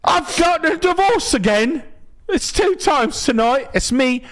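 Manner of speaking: high, fast hammed-up Cockney accent. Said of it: "Cockney accent".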